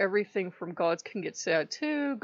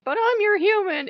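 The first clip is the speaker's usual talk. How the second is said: hurt plea; 11 semitones higher, 7 dB louder than usual. Retold or imitated. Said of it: "I'm like".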